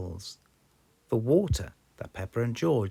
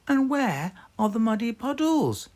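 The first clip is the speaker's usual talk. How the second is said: put-on much higher, louder little girl asking; a small piglet's voice, pitched way up; Peppa voice; 7 dB louder than usual. A narrator's reading. Peppa asking where the puddles went, much higher. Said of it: "asked Peppa".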